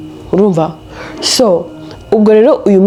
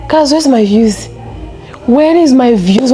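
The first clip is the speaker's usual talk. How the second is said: higher, slow whining about views; whiny mock complaint; 6 semitones higher, slower than usual.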